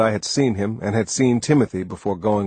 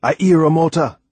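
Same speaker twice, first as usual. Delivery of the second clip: tense, raised and louder; 7 semitones higher, 5 dB louder than usual. A narrator's reading. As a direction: announce it with sudden tension.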